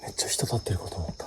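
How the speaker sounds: soft whisper after a scare; hushed, shaken aside; relieved whisper. Real, not acted. Startled by a shape in dark.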